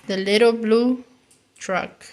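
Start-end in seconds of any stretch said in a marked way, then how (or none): none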